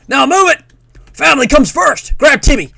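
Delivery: high, shouted American drill orders; urgent hammed-up American voice; American accent. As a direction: bellow it like a Cold War American instructor. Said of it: "like, an American voice".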